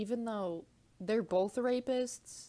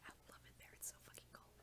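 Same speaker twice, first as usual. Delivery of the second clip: soft unvoiced whisper; 4 semitones higher, 8 dB quieter than usual. Real, not acted. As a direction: keep it all whispered. Whispering throughout.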